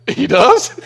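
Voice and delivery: high, loud, surprised question; a lightly played amazed woman, laughing. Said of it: "and she said".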